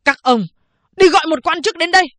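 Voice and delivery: loud, high, fast furious shout; angry shout. Acted. Prisoner curses the guards and demands an official, shouting. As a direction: bark the order at the guards. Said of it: "Tư Mã Khố chửi".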